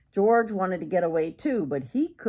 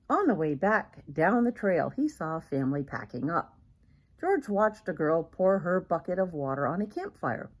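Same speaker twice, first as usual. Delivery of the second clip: usual speaking voice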